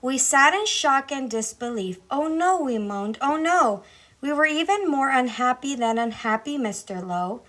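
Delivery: plain reading voice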